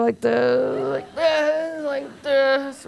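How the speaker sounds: drawn-out, droopy words as if the head flops over; exhausted lolling head. Acted. Speaker showing how tired kids' heads go, exhausted and lolling. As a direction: stretch each "this" like a wobbly, tired toddler.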